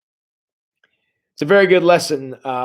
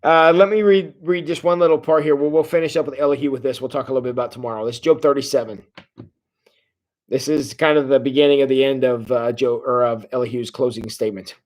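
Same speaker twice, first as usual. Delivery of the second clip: normal talking voice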